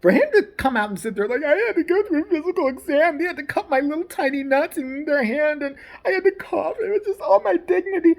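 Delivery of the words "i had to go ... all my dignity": high-pitched, tearful put-on whine; mock whiny victim. Critic mimicking an athlete's complaint about his physical, mock whining. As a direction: imitate him whimpering, pitch climbing.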